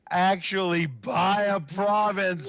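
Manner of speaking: loud, slow triumphant call; excited shout. Real, not acted. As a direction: crow it out. Big play, shouting.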